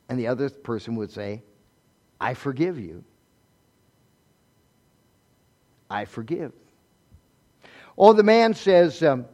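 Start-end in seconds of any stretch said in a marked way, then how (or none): 2.2-6.5 gentle, hushed pardon; quiet forgiveness. Shown as a demonstration. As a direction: answer softly and sincerely. Models the other person's forgiving reply.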